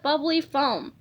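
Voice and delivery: plain reading voice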